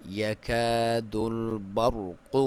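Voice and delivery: slow drawn-out tajweed chant; Quran recitation. Shown as a demonstration. Teacher recites the verse for students, slow chant. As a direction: recite it slowly with full tajweed. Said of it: "یا کو باریک clear".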